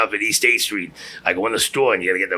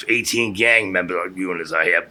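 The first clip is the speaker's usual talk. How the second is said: usual speaking voice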